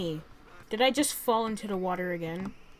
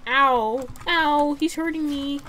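loud hammed-up ow; mock pain yell; 5 semitones higher, 9 dB louder, slower than usual. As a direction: yelp like it really hurts.

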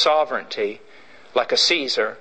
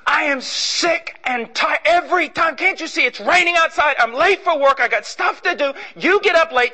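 angry rant; 10 semitones higher than usual. Retold or imitated. Husband ranting at wife over the trash, high and furious. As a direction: blow up at her, fed up and late.

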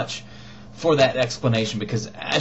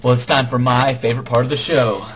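normal talking voice

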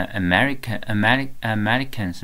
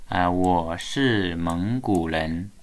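我是蒙古人 said slowly and evenly as a model; slow model sentence; slower than usual. Shown as a demonstration. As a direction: model it slowly for the student to repeat. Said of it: "学一下哈".

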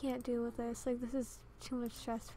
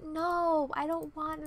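sharp, loud, higher refusal; 4 semitones higher, 6 dB louder, slower than usual. Real, not acted. Gamer refusing her Sim's baby with the wrong guy, loud no. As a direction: shut it down hard.

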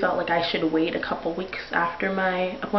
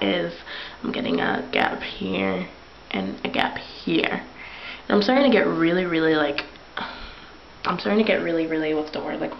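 speaking with finger on lip; finger pulling lip down. Real, not acted. Seen 0: Fingertip pulls lower lip down to show teeth gap from 5.9s.

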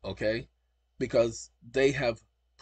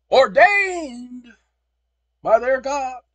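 loud, slow, high proclamation; preaching loud about God-given rights; proclaiming; 10 semitones higher, 11 dB louder, much slower than usual. Real, not acted. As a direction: orate it like a founding decree. Proclaiming again.

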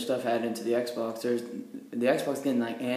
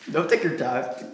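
louder, put-on waiter voice; mocking waiter voice; 3 semitones higher, 6 dB louder than usual. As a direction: lean into the waiter's line, louder. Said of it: "I like I said".

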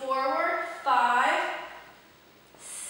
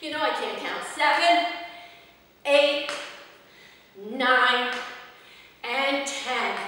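ordinary delivery

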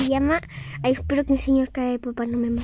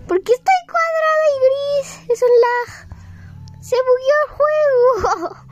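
surprised; 9 semitones higher, slower than usual. Real, not acted. Character glitched grey and square.

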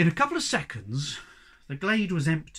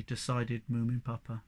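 usual speaking voice

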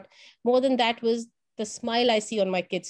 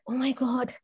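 whispered oh my God; whispered panic. Retold or imitated. Teacher whispering her panic on seeing the nurses arrive. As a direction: breathe it out in horror.

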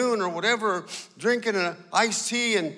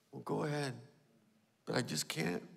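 much softer, low words; quiet resignation; 8 semitones lower, 14 dB quieter than usual. Retold or imitated. Believer answering the eleven, quiet and resigned. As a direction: let it fall to a murmur.

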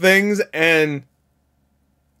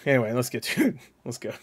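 normal talking voice

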